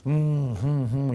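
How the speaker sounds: mock tasting hum; playful appreciative wine-tasting hum; savoring hum. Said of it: "You start tasting".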